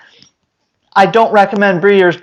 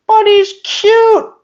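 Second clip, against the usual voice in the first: mock cooing; 12 semitones higher, slower than usual. Mock temptation of cute puppy.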